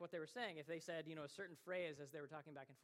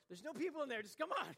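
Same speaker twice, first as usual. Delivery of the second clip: higher, louder, pushy reassurance; playful insisting; 10 semitones higher, 9 dB louder than usual. Retold or imitated. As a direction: brush off her worry and urge her on. Insisting fiancée come into cave.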